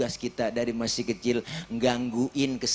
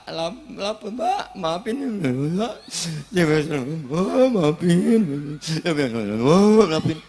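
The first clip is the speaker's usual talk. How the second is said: sobbing plea; 3 semitones higher, 7 dB louder, much slower than usual. Retold or imitated. Crying child begging mother's forgiveness.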